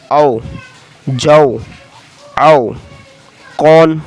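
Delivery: teacher reading words for the class to repeat; word drill. Shown as a demonstration. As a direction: call out each word clearly. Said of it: "मैं रवा से पढ़ देता हूँ".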